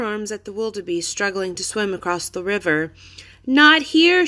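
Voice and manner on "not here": high, loud warning shout. A narrator's reading. Annie shouts to wildebeest in the river, high and loud. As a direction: wave them off, shouting.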